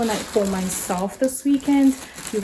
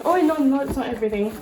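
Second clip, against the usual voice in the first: ordinary talk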